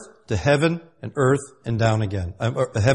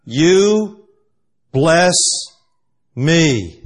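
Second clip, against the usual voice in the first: YOU, BLESS, ME each loud, alone, with pauses between; emphatic on every word; 3 semitones higher, 6 dB louder, much slower than usual. Shown as a demonstration. Jacob to the one he wrestled, emphatic word by word. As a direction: punch YOU, then BLESS, then ME separately.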